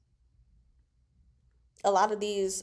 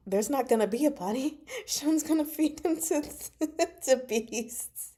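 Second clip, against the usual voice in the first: laughing; 6 semitones higher than usual. Real, not acted. Reader laughs at a chat comment about the body. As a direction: laugh through the words, voice up high.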